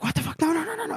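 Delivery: retold horrified no no no; panicked disbelief. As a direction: cry out no as he dies. Friend dies in front of her.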